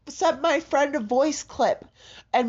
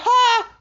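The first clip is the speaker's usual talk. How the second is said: scoffing ha; 9 semitones higher, 6 dB louder than usual. Retold or imitated. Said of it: "And I was like".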